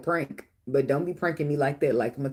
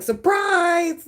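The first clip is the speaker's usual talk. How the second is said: high, loud mock "Surprise!"; spring out yelling it, cheeky; mock surprise shout; 14 semitones higher, 5 dB louder than usual. Prankster popping out at his girlfriend, high mock shout.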